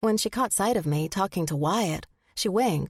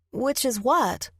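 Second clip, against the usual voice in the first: higher, puzzled and a little lost; confused young woman; 6 semitones higher, slower than usual. A narrator's reading. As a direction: ask back like she never heard the question. Aurora, distracted, missed the question.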